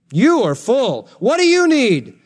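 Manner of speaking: high swooping mock wonder; sarcastic. Paul to those who thought they had it, sarcastic. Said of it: "he starts to use sarcasm".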